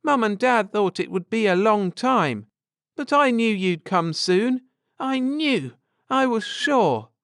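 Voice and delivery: put-on a girl who knew it all along; excited young girl. A narrator's reading. Girl to returning friends, high excited child's voice.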